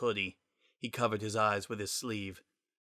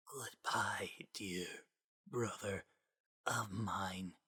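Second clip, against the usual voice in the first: faint, slow breathy near-whisper; barely there, fading away; a dying voice, soft and distant; 11 dB quieter, much slower than usual. A narrator's reading. Gaster's dying voice.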